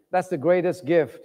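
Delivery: ordinary talk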